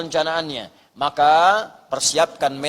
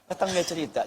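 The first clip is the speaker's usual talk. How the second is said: normal talking voice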